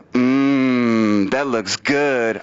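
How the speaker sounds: low, drawn-out greedy hum; mock craving. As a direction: savour it slowly, imitating a coveting girlfriend. Girlfriend eyeing his ice cream.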